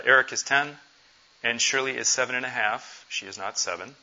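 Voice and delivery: ordinary talk, nothing marked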